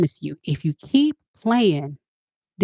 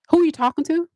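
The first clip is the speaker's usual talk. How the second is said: indignant; much faster than usual. Retold or imitated. Acting offended confrontation.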